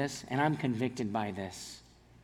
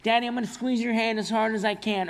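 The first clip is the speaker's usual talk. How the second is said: imitating daughter; 8 semitones higher, 4 dB louder than usual. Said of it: "they'll go".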